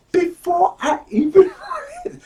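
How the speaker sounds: high, laughing through the words; tickled, high-pitched boast; amused disbelief. Real, not acted. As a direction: break into a laugh mid-sentence. Amused he anticipated the book.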